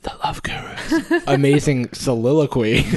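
unvoiced whisper through a laugh. Shown as a demonstration. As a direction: breathe the words, laughing. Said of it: "You have to whisper it".

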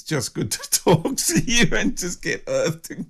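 laughing the words out, high and loud; bubbling laughter in the voice. Real, not acted. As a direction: giggle through it, delighted. Laughing while thanking her guest.

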